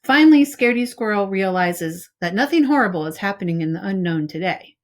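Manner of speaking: ordinary delivery, nothing marked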